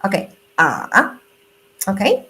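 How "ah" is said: low "Ah" dipping down then up; third tone dip. Shown as a demonstration. Teacher demonstrating the dipping third tone. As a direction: dip "Ah" low and bring it back up. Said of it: "the same pronunciation".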